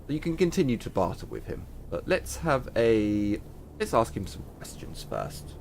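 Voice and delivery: usual speaking voice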